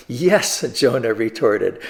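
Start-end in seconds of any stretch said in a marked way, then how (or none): 0.1-0.6 ticked-off retort. Acted. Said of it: "Jonah is really ticked off".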